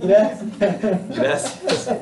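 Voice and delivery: stunned, tiny gracias; meek thanks. Retold or imitated. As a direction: squeak out a thank-you. Meek reply to harsh advice.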